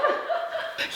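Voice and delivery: high breathy giggle. Real, not acted. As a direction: let out a high squeaky laugh. Laugh after dodging.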